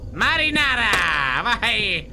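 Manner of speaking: high, celebratory exclamation; delighted shout. Real, not acted. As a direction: cry out the find with gusto. Delighted discovery of sauce.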